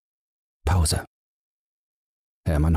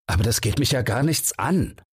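higher, a touch of irritation; irritated; 7 semitones higher than usual. Acted. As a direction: brush him off, annoyed. (Holzer weicht Bang gereizt aus)

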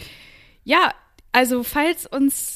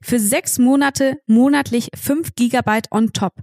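upbeat, louder sponsor read; 6 dB louder than usual. Acted. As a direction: sell it like a radio spot. Reads the sponsor ad.

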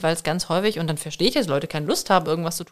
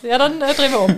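playful call; 7 semitones higher, 5 dB louder than usual. Pretend. Playacting border guard scene.

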